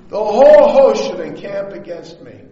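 preacher proclaiming the verse; emphatic scripture. Acted. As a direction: declare it over the crowd. Re-reads verse loudly.

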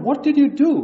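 loud, high, shocked demand; Jesus indignant, confronting the disciples; indignant outburst. Retold or imitated. Jesus rebuking disciples who shooed children away, loud and high. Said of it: "he was indignant".

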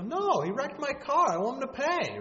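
high, loud outraged protest; indignant demand. Retold or imitated. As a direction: insist the wrecker must pay. Victim demands judge punish driver.

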